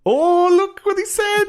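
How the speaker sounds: very high, slow, put-on kid voice; mock shocked kid. Mocking a kid gasping at a swear word, high and slow. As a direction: squeak it out like a scandalised little kid.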